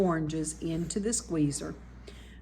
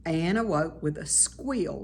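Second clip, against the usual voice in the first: ordinary delivery, nothing marked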